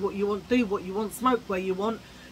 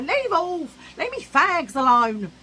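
put-on higher and louder, a cross old nan; nan telling off; 8 semitones higher, 8 dB louder, slower than usual. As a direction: snap at the kids like nan. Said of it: "She would say".

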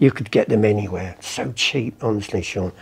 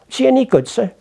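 high, innocent-sounding question; naive son; 12 semitones higher, faster than usual. Acted. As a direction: play the clueless lad deferring to an expert. Plays naive son asking expert.

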